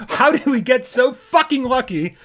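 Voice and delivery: high, loud squeal of disbelief; giddy, pitch leaping way up; real overjoyed excitement. Real, not acted. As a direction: burst out thrilled, laughing at your luck. Overjoyed at a run of game news.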